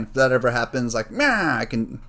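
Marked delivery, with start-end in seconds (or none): 1.1-1.6 put-on loud, high old-movie gangster squawk; hammed-up thirties mobster impression. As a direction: bleat it like a cartoon old-time gangster. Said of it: "Thirties gangster".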